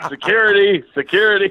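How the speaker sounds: mock alarmed call, high and loud; calling security. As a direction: call out for the guards in pretend alarm. Acted call for security guards.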